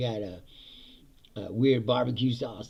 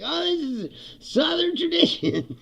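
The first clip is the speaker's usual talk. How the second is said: higher, mock-proud Southern claim; mock proud Southerner; 5 dB louder than usual. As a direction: sell vinegar sauce as a proud tradition, pitched up. Voicing Southerners after the war.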